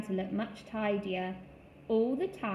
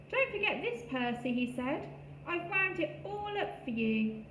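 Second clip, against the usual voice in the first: squirrel voice; 6 semitones higher than usual. A narrator's reading. Squirrel helper voice.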